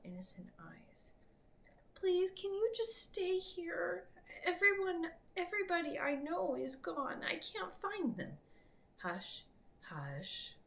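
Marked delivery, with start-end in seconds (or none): 1.9-8.4 put-on a lost little boy begging not to be left; scared child. A narrator's reading. Orphaned boy pleads with the priestess to stay, high and scared. 9.0-10.5 whispered, soothing hush; calm the frightened child in a whisper; soft hush. A narrator's reading. Priestess soothing the scared boy, whispered hush. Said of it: "she said softly to him".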